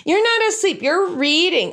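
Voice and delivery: put-on high, indignant little kid's voice; a small child protesting; kid objecting. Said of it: "kid objects to the story".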